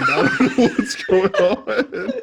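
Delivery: high, laughing through the words; can't stop cracking up while talking; helpless high-pitched giggly delivery. Real, not acted. Cracking up over his odd-sounding voice.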